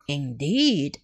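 calm lady. Acted. Miss Mary answers calmly.

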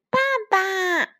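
put-on high, drawn-out child's cry for Daddy; high-pitched happy child's call; baby dinosaur calling. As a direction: call out eagerly like a little one. Baby dinosaur calls out to father.

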